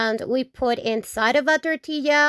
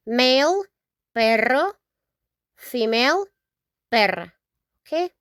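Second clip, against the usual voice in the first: usual speaking voice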